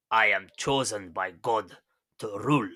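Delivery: badly faked Russian accent, lightly put on; terrible Russian accent. Podcaster quoting Tsar Nicholas in denial, terrible Russian accent. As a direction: have a poor go at a Russian accent. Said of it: "terrible Russian accent".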